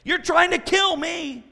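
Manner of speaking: loud, high mock outrage; exasperated retort. Preacher as Samson answering Delilah, loud exasperated outburst.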